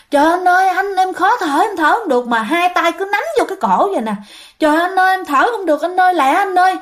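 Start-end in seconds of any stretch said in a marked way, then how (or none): 0.1-2.3 high, urgent mock cry for help; retold panicked yell. Choking man cries to his brother on the drive, imitated panicked yell. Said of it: "anh rể cứ la". 4.6-6.8 high, hurried imitation of a desperate plea; retold panicked yell. Choking man urges his brother to hurry, imitated panicked yell. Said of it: "anh rể cứ la".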